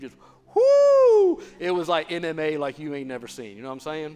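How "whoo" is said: wild whoop. Acted. Jesus fighting in death.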